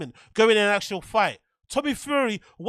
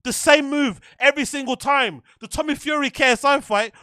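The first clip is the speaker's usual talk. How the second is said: ordinary delivery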